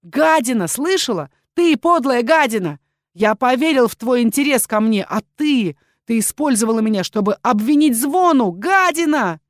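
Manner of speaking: высоко и громко, с обвинением; ори, не сдерживая ярости; яростный ор. A narrator's reading. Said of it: "уже не сдерживая свою ярость, орал". (яростно орать на предательницу)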